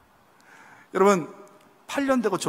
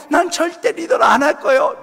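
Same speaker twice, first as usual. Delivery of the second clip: higher, louder pouty refusal; mock stubborn refusal; 9 semitones higher, 8 dB louder than usual. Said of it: "얘는요". (고집부리며 거절하는 말투)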